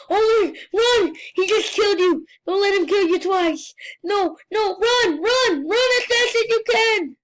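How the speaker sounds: frantic calling out; urgent shouting. Real, not acted. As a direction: yell at them to flee for their life. Urging a player to flee killer.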